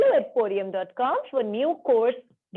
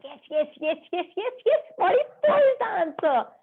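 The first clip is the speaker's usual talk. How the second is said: high, excited praise; excited cheering; 5 semitones higher than usual. Real, not acted. Teacher confirms the class's answer, excited and high. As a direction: celebrate the right answer in a rising pitch.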